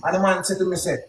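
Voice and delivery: ordinary talk, nothing marked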